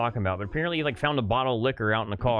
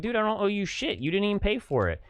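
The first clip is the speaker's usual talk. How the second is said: pitched higher, replaying a heated retort; a higher, indignant retort in the parking-lot quarrel; retold indignant retort; 9 semitones higher than usual. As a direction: relive telling off the coworker. Retold quarrel with pushy coworker.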